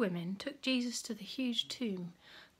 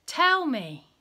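urgent demand; 5 semitones higher, 7 dB louder than usual. A narrator's reading. Jesus urgently questions the messenger.

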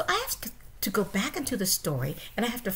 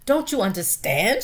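loud exasperated shout; shouting father mouse; 3 semitones higher, 6 dB louder than usual. Acted. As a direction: shout at her in frustration. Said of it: "shouted Lester".

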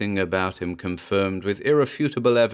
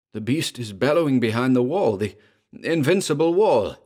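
rising, louder terrified cry; exclaimed in terror; 5 semitones higher, 3 dB louder than usual. A narrator's reading. Gurov cries out in terror at the bellowing beast, rising fear. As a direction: gasp it out in growing terror. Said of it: "exclaimed Gurov in terror".

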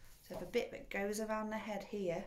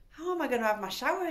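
fast, loud whiny demand; demanding father; 6 semitones higher, 6 dB louder, faster than usual. A narrator's reading. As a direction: blurt the complaint. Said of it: "he demanded".

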